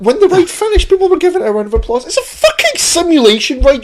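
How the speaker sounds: high-pitched, incredulous ranting; comic exasperation. Real, not acted. Telling co-host of applause on a ride, exasperated rant. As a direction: rant in disbelief, pitch climbing well up.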